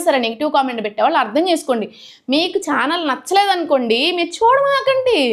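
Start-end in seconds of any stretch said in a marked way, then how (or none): 4.2-5.2 much higher, slow and pointed; indignant retort. Real, not acted. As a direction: snap it at the commenters, drawn out. Angry rant at negative commenters. (కోపంగా, నెమ్మదిగా, ఎత్తైన స్వరంతో)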